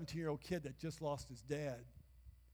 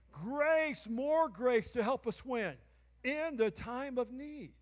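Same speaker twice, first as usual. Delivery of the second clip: loud, rising sermon cadence calling the crowd; preaching at full force; 5 semitones higher, 8 dB louder than usual. Acted. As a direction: preach it out, big and ringing. Preaching with call and response.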